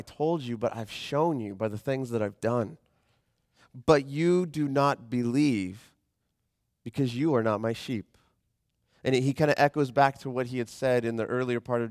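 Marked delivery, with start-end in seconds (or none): 3.9-8.2 slow scripture reading. Acted. Reads Jesus' words slowly.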